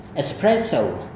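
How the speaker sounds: slow espresso with the voice rising on the stressed syllable. Shown as a demonstration. Teacher shows students where espresso rises. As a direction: lift the pitch on the marked syllable of espresso. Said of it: "suara Anda harus naik di situ".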